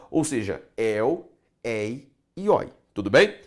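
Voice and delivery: normal talking voice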